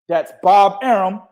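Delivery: ordinary delivery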